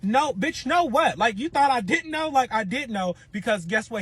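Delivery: high, loud telling-off; angry comeback on the phone; heated retort. Retold or imitated. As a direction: let her have it, indignant. Telling off the girlfriend.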